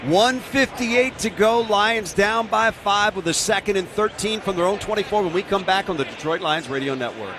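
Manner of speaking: radio play-by-play announcer. Real, not acted. Live play-by-play call.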